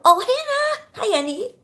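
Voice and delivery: high, bright hello; excited greeting. Real, not acted. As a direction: call out happily to a friend. Greeting viewer in live chat.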